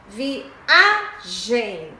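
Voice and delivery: "viagem" split, with a big A; syllable by syllable "viagem", strong on A; stressed syllable. Shown as a demonstration. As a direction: punch the middle A of "viagem". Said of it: "O A é antes da última".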